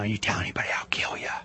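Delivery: low, menacing whisper in the ear. Retold or imitated. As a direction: whisper it as a secret threat. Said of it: "He's whispering in your ear".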